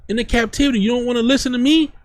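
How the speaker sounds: preacher acting out an angry God; heated God voice. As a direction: scold them as the fed-up Lord. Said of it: "I'm getting a little heated".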